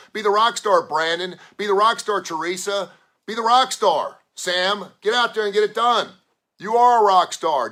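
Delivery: loud, high, rallying shout; pumped-up pep talk. Real, not acted. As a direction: fire up every listener by name. Pep talk to listeners, Monday.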